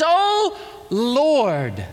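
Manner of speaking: pleading cry. Acted. Leper begging Jesus.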